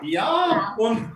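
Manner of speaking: slow, put-on German accent; mock German, slow and deliberate. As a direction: ham up a German accent, drawing words out. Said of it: "laugh with the German accent".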